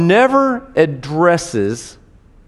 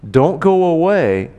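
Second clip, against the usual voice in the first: ordinary talk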